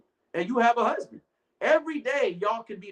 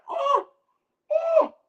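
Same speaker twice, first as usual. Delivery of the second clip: hammed-up wail, much higher; mock crying; 4 dB louder than usual. Slapped wife wails in mock tears. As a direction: fake a whimpering sob up high. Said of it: "and you start crying".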